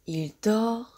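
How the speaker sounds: ordinary talk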